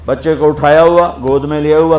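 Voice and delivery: ordinary delivery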